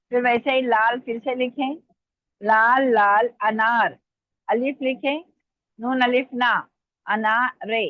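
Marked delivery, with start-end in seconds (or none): 2.4-4.0 slow, stretched words; slow dictation. Real, not acted. Teacher repeating words for students to write, slow dictation. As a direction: repeat it slowly, word by word. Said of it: "मैं आराम आराम से बोल रही हूँ".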